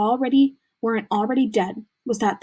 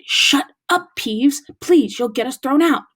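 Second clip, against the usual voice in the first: urgent plea; 4 semitones higher, 5 dB louder than usual. A narrator's reading. Pleading with Peeves to hush.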